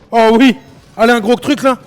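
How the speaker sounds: loud, high hype shout; rowdy party animal; excited cheering. Real, not acted. Cheering on a big win.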